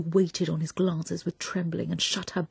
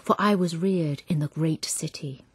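tender poem reading; slower than usual. A narrator's reading. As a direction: read it as if beside a sleeping baby. Reads a tender lullaby-like poem.

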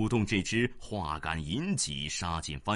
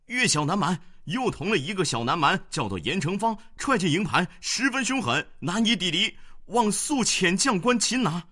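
high, loud, urgent report; alarmed soldier report; 11 semitones higher, 6 dB louder than usual. A narrator's reading. Enemy scout reporting to the commander, alarmed and loud. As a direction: blurt the bad news in a panic. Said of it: "小番报说".

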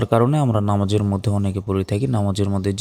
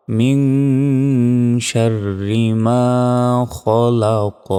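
slow tajweed recitation with held nasal; measured, sustained model recitation; Quran recitation; 3 semitones higher, 4 dB louder, much slower than usual. Shown as a demonstration. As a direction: draw out vowels and bounce the final q.

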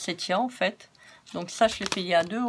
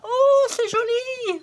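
much higher, louder and slow, a hammed-up gushing squeal; mock viewer squeal; 7 dB louder, much slower than usual. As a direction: ham up a thrilled fan squealing. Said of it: "Dites pas".

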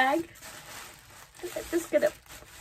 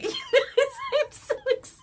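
excited laugh; slower than usual. Real, not acted. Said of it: "I am shaking".

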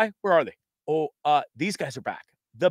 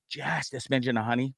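whispered aside over the cup; 3 semitones lower, 6 dB quieter than usual. Real, not acted. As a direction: breathe it to yourself. Savoring his tea cup.

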